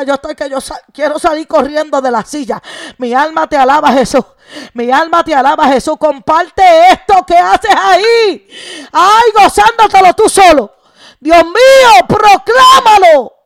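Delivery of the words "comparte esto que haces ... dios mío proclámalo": high, loud, drawn-out preaching cry; fervent preaching. Real, not acted. Preacher urges a worshipper to share the blessing, shouting fervently. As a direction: call it out to the whole church at full force.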